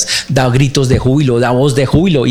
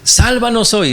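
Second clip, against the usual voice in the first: "Sálvanos hoy" called out much higher, like someone in the crowd behind Jesus; pleading crowd cry; 11 semitones higher, slower than usual. Retold or imitated. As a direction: cry "Sálvanos hoy" up high as a desperate follower. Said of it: "usted va detrás de Jesús".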